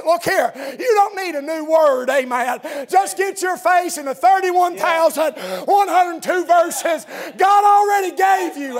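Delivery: rising pulpit shout; full-force preaching. Real, not acted. Preacher urging congregation into the Bible, impassioned rising shout. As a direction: drive it up to a drawn-out ringing cry.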